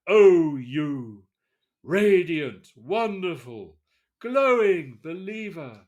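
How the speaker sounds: hammed-up proletarian poem, loud and slow; mock poem recital. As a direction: declaim it like an overblown revolutionary ode. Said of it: "Here's a taste, a little poem".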